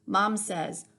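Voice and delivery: ordinary delivery, nothing marked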